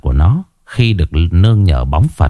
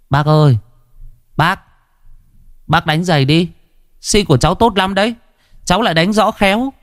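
put-on high, quick child's sales pitch; eager little hawker, pitched well up; pleading shoeshine boy; 9 semitones higher than usual. A narrator's reading. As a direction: coax the customer in a high boy's voice.

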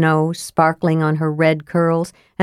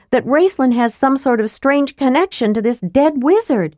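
put-on young woman voice; 6 semitones higher than usual. A narrator's reading. Tika voiced by narrator.

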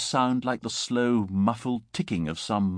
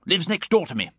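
higher-pitched, peeved complaint; irritated grumbling; 7 semitones higher than usual. A narrator's reading. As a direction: complain about a maddening neighbour. Gibbon grumbles about his neighbour.

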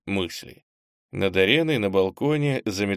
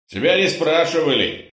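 loud angry bark, higher; 6 semitones higher, 5 dB louder than usual. A narrator's reading. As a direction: snap it loudly at him. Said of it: "рявкнул хозяин".

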